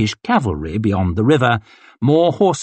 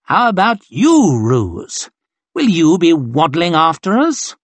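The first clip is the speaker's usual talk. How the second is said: higher, louder sneer; 6 semitones higher, 4 dB louder than usual. Acted. Colonel sneering at Ruse before the officers. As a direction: curl the lip at the fat officer. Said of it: "He curled his lip".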